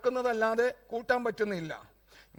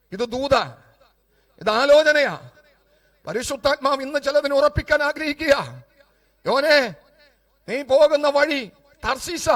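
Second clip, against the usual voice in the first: raised, prophetic proclamation in short phrases; forceful preaching; 5 semitones higher, 9 dB louder than usual. Acted. Preacher calling Israel's people to turn back, forceful preaching. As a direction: call it out over the whole congregation.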